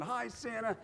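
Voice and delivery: put-on high, eager child's greeting; kids calling Santa. Kids at the parade greeting Santa, high and eager. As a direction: pitch it up like a thrilled kid. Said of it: "our kids are all going".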